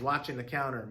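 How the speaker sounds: ordinary delivery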